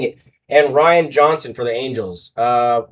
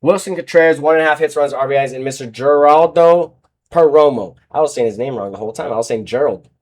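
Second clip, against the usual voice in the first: normal talking voice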